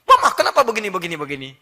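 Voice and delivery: loud complaining outburst; mock angry husband complaining at home. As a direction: mimic a husband ranting at his wife. Husband angry, wife voiced.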